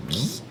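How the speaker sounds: low buzzing zipper noise; zipping sound. Retold or imitated. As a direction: buzz like a zipper pulled up. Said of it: "That was a pretty good zipper impression".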